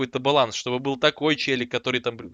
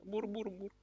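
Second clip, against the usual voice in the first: soft breathy kitten purr, much quieter; cute purring; 7 semitones higher, 17 dB quieter, slower than usual. Shown as a demonstration. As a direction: purr it softly like a pet kitten. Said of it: "Вот так вот надо показывать". (тихое кошачье мурлыканье)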